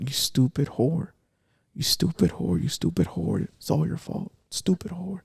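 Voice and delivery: half-whispered taunt echoing in the head; quietly voicing the abuse she kept hearing; hushed tormenting voice.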